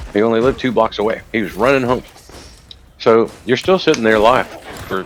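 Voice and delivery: ordinary talk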